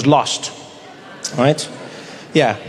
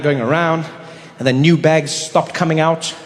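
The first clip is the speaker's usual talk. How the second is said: ordinary delivery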